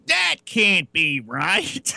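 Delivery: very slow, drawn-out disbelief. Retold or imitated. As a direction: drag it out, not believing it. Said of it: "Fun Dip goes".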